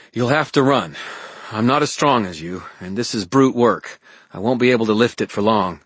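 pressing, practical warning; Elaine warning earnestly. Acted. Voices Elaine instructing Harry.

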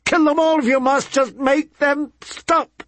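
grieving mother begging her son to stop the howls; hysterical crying. Acted. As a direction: break down completely, begging. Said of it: "she cried".

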